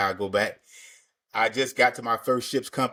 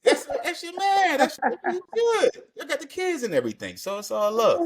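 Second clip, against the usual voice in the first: high teasing voice. Acted. Teasing guest about his friend.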